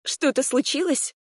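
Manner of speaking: high-pitched, alarmed question; frightened question. A narrator's reading. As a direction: ask in sudden worry, pitch jumping up. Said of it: "испуганно спросила я". (испуганный вопрос высоким голосом)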